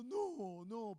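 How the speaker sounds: mother saying no. Retold or imitated. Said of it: "La mamá estaba todo el día diciendo".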